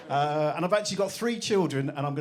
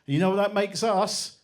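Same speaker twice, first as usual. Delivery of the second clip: usual speaking voice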